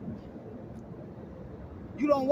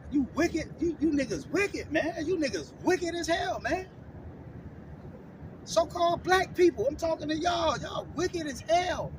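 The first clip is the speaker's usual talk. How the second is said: rising rebuke; 5 semitones higher than usual. Real, not acted. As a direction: call them out, building to a shout. Preacher rebuking his audience.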